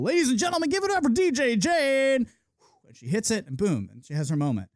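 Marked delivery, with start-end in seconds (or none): 0.0-2.3 higher hype-man voice, slowed down; club announcer. Retold or imitated. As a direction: announce her like a big-night headliner. DJ hypes the crowd.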